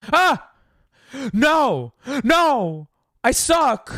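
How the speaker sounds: high, loud frustrated cries; high anguished shout; frustrated yell. Real, not acted. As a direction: yell at the game as you fail. Dying in game.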